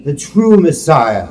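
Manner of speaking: usual speaking voice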